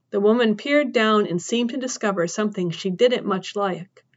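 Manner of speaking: plain reading voice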